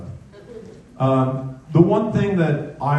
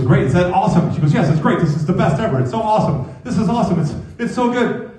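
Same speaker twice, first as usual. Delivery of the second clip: higher-pitched, building delight; wife's mock ecstasy; 7 semitones higher, faster than usual. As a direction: rave with rising excitement, imitating the wife. Wife delighted by cold foot.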